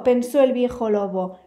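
plain reading voice

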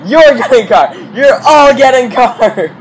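high, loud talk-show host shouting giveaways; booming excited talk-show giveaway; Oprah giveaway. Acted. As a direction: shout it like a game-show host giving away cars. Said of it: "Oprah Winfrey?".

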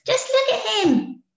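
high, loud little bees; bees scoffing. A narrator's reading. Little bees telling Amelia she's wrong, high and loud. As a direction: brush off the silly idea.

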